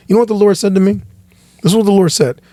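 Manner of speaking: ordinary delivery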